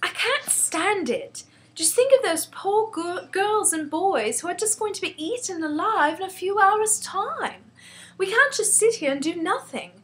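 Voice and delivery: put-on high-pitched, impassioned girl crying out; a child's indignant outburst; distressed outcry. A narrator's reading. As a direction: plead urgently in a high voice. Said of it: "she stood up and cried out".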